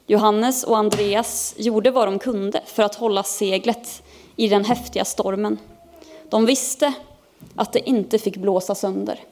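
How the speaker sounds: plain reading voice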